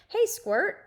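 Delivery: high, loud cheerful call; loud greeting. A narrator's reading. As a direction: call out to your little sister. Brother greeting loudly.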